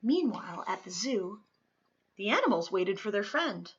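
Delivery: ordinary delivery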